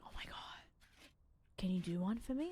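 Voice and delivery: breathless excited whisper; mock the eager asker, hushed; hushed eager request; eager fan whisper. Mimicking eager people.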